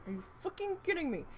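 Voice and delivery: high fast exasperated outburst; furious disbelief. Retold or imitated. Learning they still hadn't dumped, fast furious disbelief. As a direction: spit it out in disbelief. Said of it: "I was so mad".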